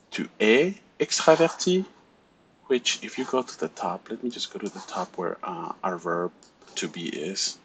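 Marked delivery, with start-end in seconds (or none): 0.1-1.8 Tu es extraverti with extraverti rising high at the end; question intonation. Shown as a demonstration. Teacher models a question for students, rising on extraverti. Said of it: "you can ask questions with intonation".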